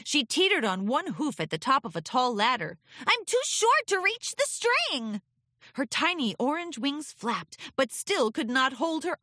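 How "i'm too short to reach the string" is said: high, whiny little pony; pleading filly. Acted. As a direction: complain you can't reach. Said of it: "Scootaloo pleaded".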